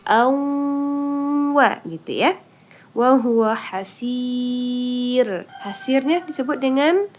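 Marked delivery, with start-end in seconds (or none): 0.0-1.8 "Awwa" drawn out slowly, held and higher; nasal dengung demo. Shown as a demonstration. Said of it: "Maka dibaca dengung". 2.9-5.5 slow chanted "Wa huwa hasir"; Quranic recitation. Shown as a demonstration. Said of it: "Maka dibaca dengung".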